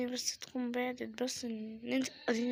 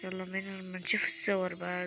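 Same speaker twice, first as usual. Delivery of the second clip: normal talking voice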